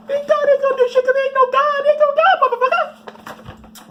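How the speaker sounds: shrill, loud defiant yell; defiant shout. Acted. Comic yelling at offended believers, high and loud.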